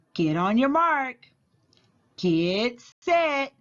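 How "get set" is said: plain reading voice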